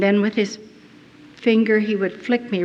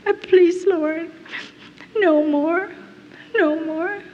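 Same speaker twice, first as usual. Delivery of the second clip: tearful prayer; 6 semitones higher than usual. Retold or imitated. Prisoner alone in her cell praying to Jesus, weeping. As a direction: beg God through tears. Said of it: "And I cried".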